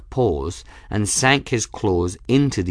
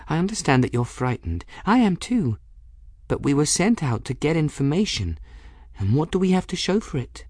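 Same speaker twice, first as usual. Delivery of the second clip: put-on higher-pitched girl, reasoning firmly; earnest girl; 7 semitones higher than usual. Bridget persuading frightened Folly to go on, earnest.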